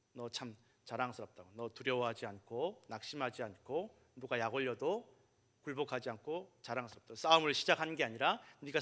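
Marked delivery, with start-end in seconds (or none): 0.1-7.1 soft, lower, tender praise; proud father. Retold or imitated. Father praising his son back from school, softly proud. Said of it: "자랑스럽더라고".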